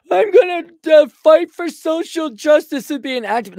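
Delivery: very high, loud squeaky caricature; mock an eager activist in an exaggerated falsetto. Mocks a would-be academic activist.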